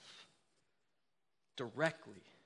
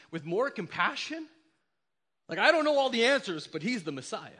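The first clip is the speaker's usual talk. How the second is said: believers insisting; 4 semitones higher, 7 dB louder than usual. Acted. Believers voiced, building to exclaim.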